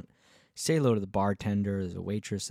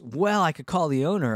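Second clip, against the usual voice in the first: lifted, louder clerk; mock clerk voice; 7 semitones higher, 7 dB louder than usual. Retelling the desk clerk's offer, higher and louder.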